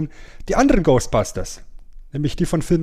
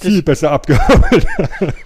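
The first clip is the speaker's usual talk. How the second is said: louder, laughing through the words; 4 semitones higher, 9 dB louder, slower than usual. Real, not acted. Joking to his co-host about his age, laughing. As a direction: laugh out the punchline of your own joke. Joking he was forty-five then.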